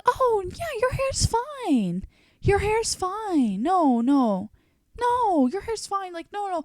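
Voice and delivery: very high, drawn-out singsong placating; mock reassurance. Stylist insisting client's hair is fine, high mock reassurance. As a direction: imitate an unconvincing stylist in a squeaky high voice.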